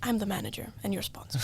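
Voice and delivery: whispered, like letting her in on a secret. Retold or imitated. Manager telling the racer she sponsors her, whispered. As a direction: hush it out, all breath and no voice.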